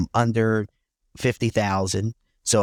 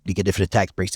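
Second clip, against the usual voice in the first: ordinary talk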